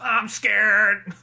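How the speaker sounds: imitated cartoon voice, played for laughs; Ned. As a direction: repeat the comic mock-scared catchphrase. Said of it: "the way he says that".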